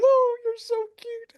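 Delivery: high, slow gushing coo; cooing woman's voice. Retold or imitated. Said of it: "she goes".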